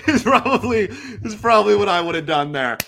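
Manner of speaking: real laughter through the words; can't get the words out for laughing at your own joke. Real, not acted. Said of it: "let's all have a laugh about it".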